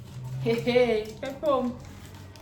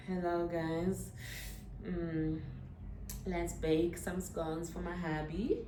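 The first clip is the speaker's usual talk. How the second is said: soft, slow dreamy housewife voice; Nara Smith impression; 3 semitones lower, 13 dB quieter, slower than usual. Vlogger mimicking a tradwife baker, soft and slow. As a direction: hush it down, slow and breathy-soft like a lifestyle influencer. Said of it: "I don't know how to do that accent".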